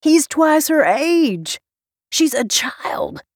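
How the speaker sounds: high, slow, emphatic disgust. A narrator's reading. Jonah objects to the age gap, scowling in disgust. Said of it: "Jonah scowled in disgust".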